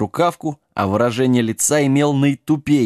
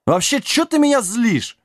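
high-pitched riled complaint; irritated; 11 semitones higher than usual. A narrator's reading. Mad king riled by giant, irritated. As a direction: snap at the one provoking you.

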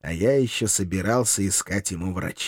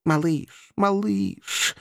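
high, drawn-out gentle scolding; affected reproach; reproachful; 8 semitones higher, much slower than usual. A narrator's reading. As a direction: chide a naughty little one. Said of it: "укоризненно покачал головой Эшли".

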